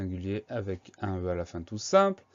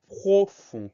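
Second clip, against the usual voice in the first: profond drawn out slowly, louder; slow dictation; 9 semitones higher, 7 dB louder than usual. Shown as a demonstration. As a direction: stretch out profond syllable by syllable. Teacher presents word to spell.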